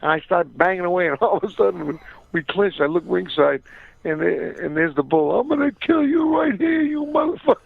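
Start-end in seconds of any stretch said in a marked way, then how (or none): 5.4-7.6 much higher, hammed-up menacing threat; mock gangster threat, pitched way up; retold death threat. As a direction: play the furious mobster snarling from ringside. The Bull threatening him ringside.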